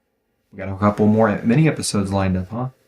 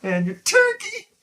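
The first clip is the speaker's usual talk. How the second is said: high, excited proclamation, stretched at the end; hammed-up holiday cheer; 10 semitones higher than usual. Host wishing listeners a happy Thanksgiving, hammed-up excitement. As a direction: belt out a mock-festive greeting, pitched up.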